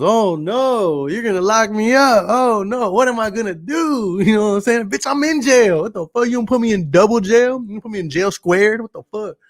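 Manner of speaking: high, loud sarcastic mock panic; mock him with fake terror; sarcastic oh-no voice taunting a guard; mocking fake fear. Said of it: "He goes".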